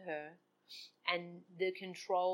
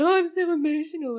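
mock self-pity; 9 semitones higher, 12 dB louder than usual. Mocking her own self-talk.

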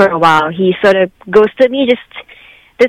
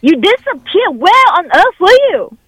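raised, higher angry voice; 8 semitones higher than usual. Real, not acted. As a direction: pitch up, furious disbelief. Confronting ex who ghosted her.

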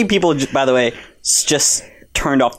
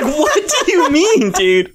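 high, drawn-out mock incredulity; retold bafflement; slower than usual. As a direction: laugh through a baffled protest. Retold baffled reply to driver.

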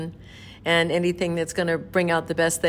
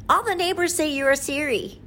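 high-pitched imitation of a little boy; 10 semitones higher than usual. Boy at the door questioning the neighbor, in a high child's voice. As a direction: pitch it way up like a small kid. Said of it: "a little boy came up".

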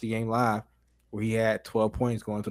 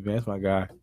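ordinary talk

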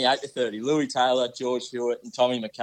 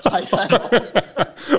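loud, high laughing order; real laughter bursting through the words; 9 semitones higher, 8 dB louder, much slower than usual. Real, not acted. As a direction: crack up while saying it. Teasing co-host about his outfit.

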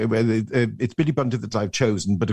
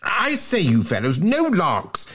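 high, flustered schoolboy plea; gasping protest; 8 semitones higher, slower than usual. Acted. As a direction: protest as if caught on the stairs. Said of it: "gasped Bunter".

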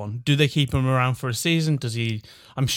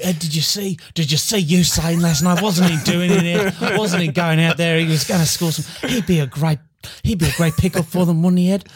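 eager optimistic fan; 5 semitones higher than usual. Retold or imitated. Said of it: "an optimistic little, rascal".

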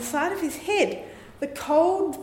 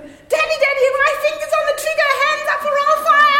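put-on high, fast little girl threat; childish hold-up; 6 dB louder, faster than usual. Daughter again threatening dad with the gun, high fast child voice. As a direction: threaten like a gleeful child with a toy. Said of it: "and sort of".

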